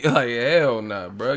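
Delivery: ordinary talk, nothing marked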